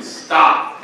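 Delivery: loud, abrupt order; commanding stop. Retold or imitated. Sheikh tells him to stop, commanding. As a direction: imitate a sheikh cutting in forcefully.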